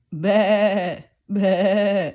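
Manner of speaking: loud bleating lamb. Acted. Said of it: "Lamb stays close to Mommy Ewe".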